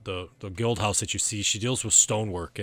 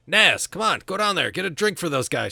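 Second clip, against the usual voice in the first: raised, high husband's holler; calling out; 9 semitones higher, 7 dB louder than usual. Acted. Riddick orders his wife to serve those guys, calling out loudly. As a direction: call across the bar to your wife. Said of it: "He's like".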